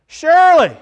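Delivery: loud, high, fervent preacher's call; preaching. Acted. Pastor to congregation, preaching loud and fervent. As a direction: pour it out like a revival preacher.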